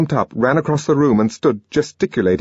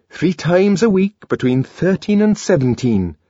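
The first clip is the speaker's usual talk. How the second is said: lightly voiced eager young man; Bernard voice; 10 semitones higher, 3 dB louder than usual. A narrator's reading. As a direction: chime in eagerly. Bernard chimes in.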